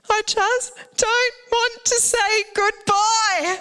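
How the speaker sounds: the son saying goodbye through tears; imitating tearful boy. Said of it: "he said to me through tears".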